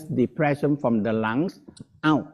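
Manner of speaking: usual speaking voice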